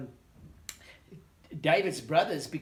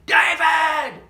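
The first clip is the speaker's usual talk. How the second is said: calling out; 11 semitones higher, 15 dB louder than usual. Acted. Father calling son from field.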